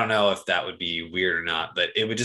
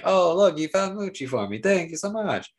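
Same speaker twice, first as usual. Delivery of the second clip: mock Bib Fortuna voice; 9 semitones higher than usual. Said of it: "episode being like".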